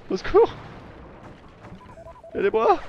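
high, loud fake cry for help; hammed-up call for rescue; mock cry for help.

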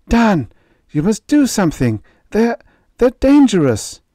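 high, urgent, frightened plea; pleading wife. Acted. Wife begging her husband to act, frightened and high. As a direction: beg him, voice rising in fear.

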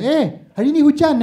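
high, loud friendly Malay small talk; chirpy local asking about the rain; mock Malay neighbour making small talk; Malay person chatting. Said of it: "Malay people come up to you like".

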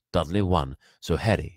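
ordinary delivery

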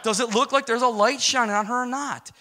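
high, loud, insisting he is serious; insistent shout. Retold or imitated. He presses his buddy about the light, high insistent shout. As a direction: push the question hard and loud. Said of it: "I said".

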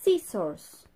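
loud, over-clear Scissors for copying; slow clear model word. Shown as a demonstration. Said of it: "Repeat after me".

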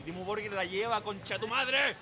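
high, exuberant curse; rowdy fan yell. Real, not acted. Chilean fan hailing the band, rowdy yell.

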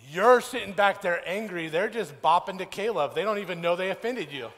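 worked-up preacher making the point; exasperated rant. Acted. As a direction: belt it out at the crowd. Mock exasperation at angry drivers.

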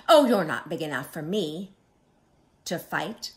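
loud scoffing oh, then easing off; grouchy storybook bluff; blustering brush-off. Acted. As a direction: dismiss them to cover backing down. Grouchy bug backing down with bluster.

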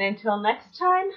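cheery goodbye. Real, not acted. Vlog sign-off.

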